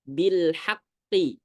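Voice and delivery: bil haqqi drawn out slowly, heavy emphatic qaf; slow, careful Quranic reading of bil haqqi; tafkhim demo. Shown as a demonstration. Teacher demonstrating to the congregation, slow emphatic recitation. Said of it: "maka cara bacanya contoh".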